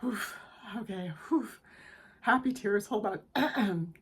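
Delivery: about to cry. Real, not acted. Reader overcome by the message, about to cry. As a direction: puff out breaths to hold back tears. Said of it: "I'm gonna cry".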